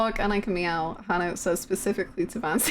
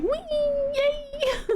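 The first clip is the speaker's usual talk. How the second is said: squeaky happy yelp. Retold or imitated. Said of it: "And I'm like".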